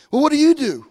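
stranger's question. Retold or imitated. The stranger asks about him.

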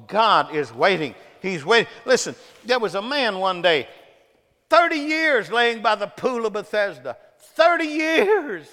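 loud sermon cry, climbing high; full-force preaching. Acted. Preacher telling of the long wait at Bethesda, full-force preaching.

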